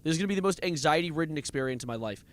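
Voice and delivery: ordinary talk, nothing marked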